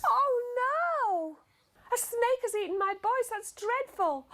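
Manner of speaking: high, loud panicked cry; alarmed mum. Acted. Mother fearing a snake ate her boys, panicked.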